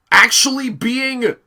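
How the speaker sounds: milk the disbelief; mock astonishment. Real, not acted. Mock shock at Thomas.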